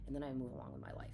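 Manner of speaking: normal talking voice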